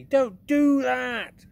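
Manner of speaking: very high, loud and slow giant outcry; pained protest. Acted. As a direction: cry out, stretching the words. BFG protests pain.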